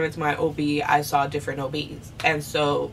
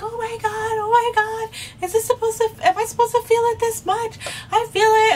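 much higher, louder, frantic oh my God; panicked cry; 4 dB louder than usual. Retold or imitated. Mother in surgery panicking to the anesthesiologist, high frantic cry. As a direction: squeal it out in alarm as the pressure hits.

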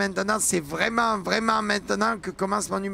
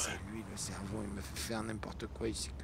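whispering; 12 semitones lower, 16 dB quieter than usual. Acted. Keeps whispering the secret.

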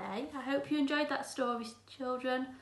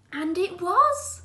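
excited reveal; 8 semitones higher, 12 dB louder, slower than usual. A narrator's reading. Joyful reveal in story.

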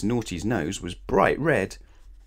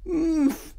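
high muffled cry through bandages; muffled oomph. Acted. Mister Small crying out through his bandages, muffled. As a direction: squeak it out as if gagged. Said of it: "he cried".

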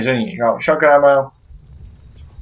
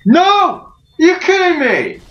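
outraged outcry; 11 semitones higher than usual. Real, not acted. Gamer crying out after dying yet again. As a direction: howl in disbelief at the screen.

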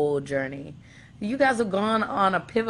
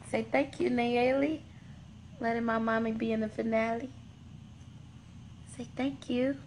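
higher, softer sing-song prompting; 5 semitones higher, 7 dB quieter than usual. Real, not acted. Mom prompting baby Nayeli to thank.

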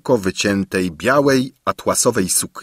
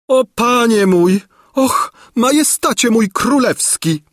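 put-on much higher, louder swooning old lady; exclaimed; 5 dB louder than usual. A narrator's reading. Gburia Furia gushing at the king, high loud exclamation. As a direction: cry out to the king in rapture. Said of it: "wykrzyknęła Gburia Furia".

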